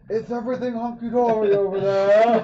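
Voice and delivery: booming voice. Acted. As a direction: call out like a booming echo. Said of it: "make my voice echo and boom super loud".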